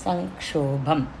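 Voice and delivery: సంక్షోభం slow, the ం as a throaty ఙ; velar ng in sankshobham. Shown as a demonstration. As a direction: sound సంక్షోభం with ng at the back of the throat. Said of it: "గొంతు మధ్యలోకి వెళ్ళి రావాలి". (సంక్షోభం లో ఙ గొంతు మధ్యలోంచి)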